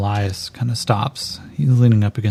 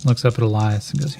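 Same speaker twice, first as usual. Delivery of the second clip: ordinary talk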